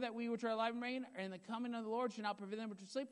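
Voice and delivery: ordinary talk, nothing marked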